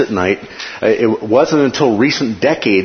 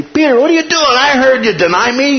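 scolding accusation; 4 dB louder than usual. Pretend. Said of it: "instead of coming up to him and say".

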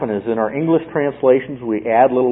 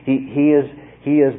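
ordinary talk

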